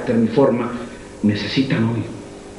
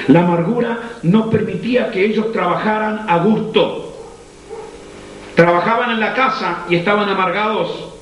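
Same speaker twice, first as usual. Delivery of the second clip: predicación encendida en tono alto; predicación intensa; 9 semitones higher than usual. Acted. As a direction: predica con toda la fuerza, voz alta. Predica con fuerza en alto. (predicador encendido ante la congregación)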